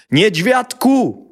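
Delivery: long, slow, loud high call. A narrator's reading. Father calling his son from outside. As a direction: call the name across the house.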